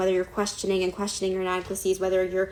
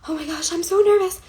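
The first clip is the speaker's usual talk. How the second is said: mock nervous; 10 semitones higher, 5 dB louder than usual. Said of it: "instead of saying".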